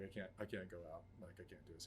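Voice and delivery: soft, defeated refusal; hushed, helpless reply to a friend; retold despair. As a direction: say it quietly, giving up.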